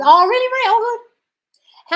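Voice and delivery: high, loud delighted surprise. Real, not acted. Live coach reacting to a fan's breakthrough, delighted. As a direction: gush with pleasure at good news.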